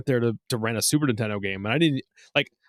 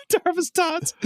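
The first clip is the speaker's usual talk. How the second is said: laughing; slower than usual. Real, not acted. Laughing at a silly name.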